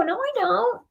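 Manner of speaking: high, soft, indignant "no, I don't"; mock denial. Reader mimicking the client denying the karma, in a high indignant voice. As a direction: play the client brushing it off.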